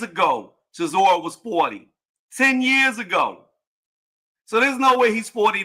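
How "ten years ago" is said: "Ten" pitched high and drawn out slowly; insistent emphasis. Real, not acted. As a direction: hammer "Ten years ago" slowly, high on Ten. Insisting about Chisora's age.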